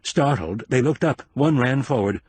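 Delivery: ordinary delivery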